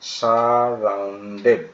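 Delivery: সারাউন্ডেড drawn out slowly in separate syllables; teacher's slow, split-up সারাউন্ডেড. Shown as a demonstration. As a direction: break সারাউন্ডেড into stretched sar-roun-ded. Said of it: "বড় উচ্চারণ পেলে ভেঙে ভেঙে করবে".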